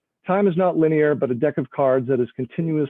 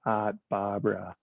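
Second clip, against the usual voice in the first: Art Barbara in a Boston accent, lower and much softer, R's dropped; 6 semitones lower, 10 dB quieter, slower than usual. Shown as a demonstration. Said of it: "spoken with a Boston or Rhode Island accent".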